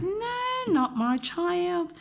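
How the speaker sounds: high, slow, outraged denial from a parent. Retold or imitated. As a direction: drag it out like an indignant mother. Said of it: "they're like".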